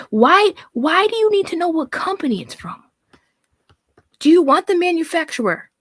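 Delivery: exasperated at her demands. Real, not acted. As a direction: blast her with disbelief. Exasperated ranting at the hog.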